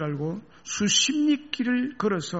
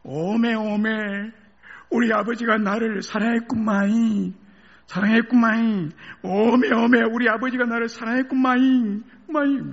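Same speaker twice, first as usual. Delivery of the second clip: loud, high Jeolla cry of realization; Jeolla dialect; 8 semitones higher, 5 dB louder than usual. Acted. Grown son realizing his father's love, loud Jeolla dialect cry. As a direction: burst out in Jeolla dialect, overcome. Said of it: "전라도 말 대신 한번 해줄래요".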